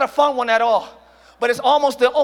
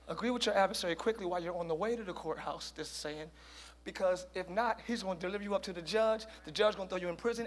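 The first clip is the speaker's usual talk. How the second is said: quiet, low, hushed explanation; much softer aside; 6 semitones lower, 16 dB quieter than usual. Real, not acted. Preacher quietly unpacking the verse, much softer. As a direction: drop the volume and explain. Reading verse softly, distant.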